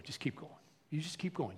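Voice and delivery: hushed steady reassurance; quiet encouragement. Acted. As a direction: urge them on gently, voice dropped. Acts God steadily urging Abraham on.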